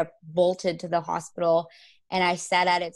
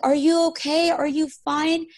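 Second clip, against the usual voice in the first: imitating mom; 9 semitones higher, 5 dB louder than usual. Mom fussing over her.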